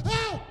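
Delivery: high startled mock cry; scared yelp. John startled in the kitchen, mock yelp. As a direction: yelp in surprise. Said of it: "And I'll come in and I'll go".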